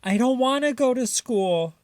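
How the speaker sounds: high, whiny little voice near tears; a small raccoon pleading not to go, crying; crying child raccoon. A narrator's reading. Chester refusing school to his mother, crying and high.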